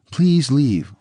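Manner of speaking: read-out imploring appeal; pleading. A narrator's reading. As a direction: beg him to go, voice lifted. Reads out Nicole begging him to go.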